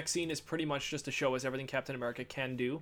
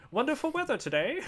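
high, put-on prim lady voice; shop woman voice; 11 semitones higher than usual. Host imitating the film's shop woman in a high put-on voice. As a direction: chirp it like a prim shopkeeper.

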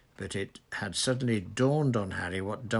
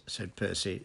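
plain reading voice

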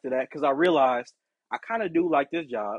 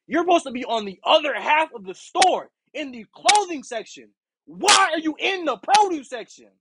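heated rant; 6 semitones higher than usual. Retold or imitated. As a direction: tell the manager off. Imagined rant at manager.

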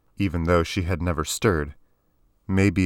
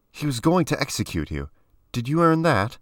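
challenging; 9 semitones higher than usual. Acted. Alexstra challenging Kiani.

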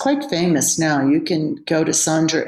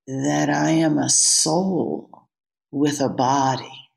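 ordinary delivery

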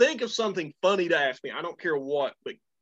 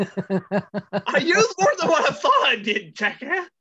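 high, slow and mushy through a numbed tongue; words smeared by numbing spray, laughing; comic duck-like slur from the spray; numb-mouth Daffy Duck voice; 10 semitones higher, 5 dB louder, slower than usual. Real, not acted. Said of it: "You sound like Daffy Duck".

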